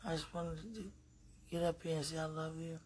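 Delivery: half asleep, drifting off; nodding off. Real, not acted. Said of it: "I'm dozing off".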